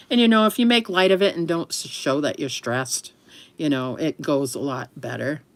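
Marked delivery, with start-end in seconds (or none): none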